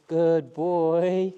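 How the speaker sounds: high, loud encouraging praise for a dog. Real, not acted. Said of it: "that nice tone of voice".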